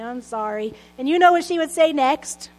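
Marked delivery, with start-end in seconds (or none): 0.0-0.7 reluctant mumble, softer; grudging kid's mumbled sorry; mumbled apology. Retold or imitated. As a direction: mumble it like a scolded child. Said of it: "I would mumble something like".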